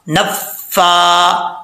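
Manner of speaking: very slow drawn-out word; slow recitation. Shown as a demonstration. Teacher recites the joined syllables very slowly. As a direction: stretch each vowel. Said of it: "फा खड़ा ज़बर फा".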